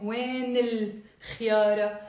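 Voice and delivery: وين الخيارة linked as wayn-il, then khyara; demonstrated liaison. Shown as a demonstration. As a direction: stretch the join between وين and the article. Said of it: "Notice the liaison".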